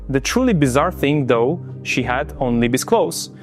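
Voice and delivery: usual speaking voice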